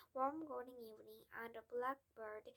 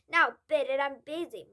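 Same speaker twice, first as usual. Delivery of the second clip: much louder, brusque dismissal; dismissive father; 3 semitones higher, 17 dB louder than usual. Acted. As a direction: shoo the child away.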